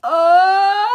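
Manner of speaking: sharp high yelp of pain. Real, not acted. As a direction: cry out as it rips. Ripping makeup off, pain.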